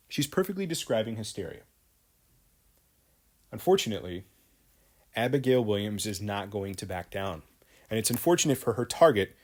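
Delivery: ordinary delivery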